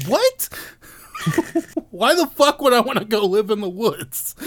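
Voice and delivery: high, laughing disbelief; incredulous. Retold or imitated. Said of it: "And I'm like".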